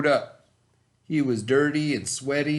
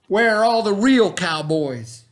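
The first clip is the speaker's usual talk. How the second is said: higher, louder bored lament; complaining; 7 semitones higher, 6 dB louder than usual. Acted. Miss Lurleen complaining to the room, higher and louder. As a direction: whine for a real cowboy. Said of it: "she complained".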